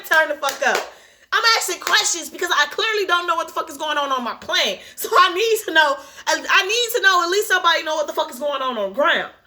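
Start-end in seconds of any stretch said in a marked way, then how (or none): none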